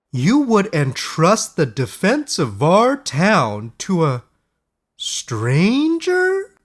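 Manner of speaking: put-on high, slow, fussy old councilman voice; a scandalised town elder, pitch climbing to the end; voiced up high as a doubting elder, dragging the words; incredulous Oliver. A narrator's reading. Oliver objects to trusting a stranger.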